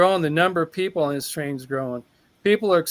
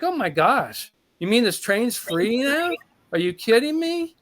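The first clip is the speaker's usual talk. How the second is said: retold astonishment, pitch climbing high; incredulous questions rising higher and higher; amazed disbelief; 6 semitones higher than usual. Passenger to engineer on learning the train is free, amazed disbelief. Amazed at getting money back.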